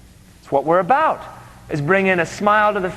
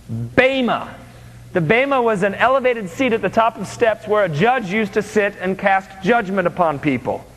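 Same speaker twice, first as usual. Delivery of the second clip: ordinary talk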